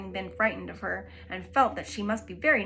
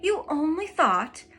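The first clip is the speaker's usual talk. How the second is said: scornful scolding; 2 semitones higher, 6 dB louder than usual. Acted. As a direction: cut the daughter down sharply. Mother scolds daughter.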